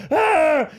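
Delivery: high, loud yell of pain; retold loud pain cry. Retelling the dislocation, a loud high yell. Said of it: "And then I was like".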